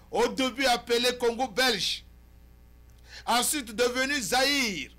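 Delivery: loud, high, emphatic oratory; forceful editorial monologue. Acted. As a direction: declaim to the viewers in punched phrases.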